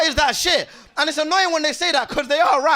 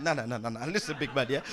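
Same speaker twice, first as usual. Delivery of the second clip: low, much softer interruption; hushed objection; 11 semitones lower, 12 dB quieter than usual. Acted. Passenger stopping the safety briefing, low quiet objection. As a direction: cut in quietly, under the announcement.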